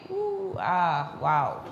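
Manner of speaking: imitating her starstruck ooh and ah; swooning ooh ah. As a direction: swoon over him like she did. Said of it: "and she saw him like".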